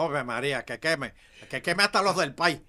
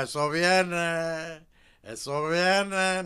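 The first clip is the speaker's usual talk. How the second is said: slow teasing; 2 semitones higher, 4 dB louder, much slower than usual. Acted. Teasing, don't spoil it.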